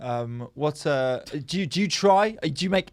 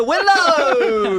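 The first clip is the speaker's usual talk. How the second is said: announcer shout; 6 semitones higher, 5 dB louder than usual. Pretend. Calling out radio guest.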